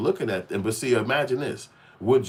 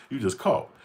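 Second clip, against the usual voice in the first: normal talking voice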